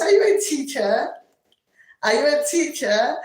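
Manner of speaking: ordinary talk, nothing marked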